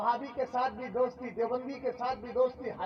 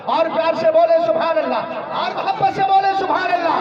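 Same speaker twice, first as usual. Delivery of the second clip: louder and higher, laughing as he urges them; laughing exhortation; 5 semitones higher, 13 dB louder than usual. Real, not acted. Preacher laughing, urging the crowd to say it lovingly. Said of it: "मोहब्बत से जरा बोलिए".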